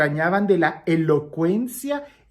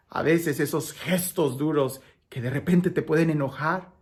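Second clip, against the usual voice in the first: usual speaking voice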